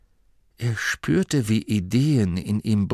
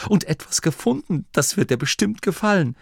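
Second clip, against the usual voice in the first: high, excited announcement of a find; eifrig; 9 semitones higher, 3 dB louder than usual. A narrator's reading. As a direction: burst out with eager news. Vinto eagerly reports his find. (aufgeregt und stolz, mit hoher Stimme)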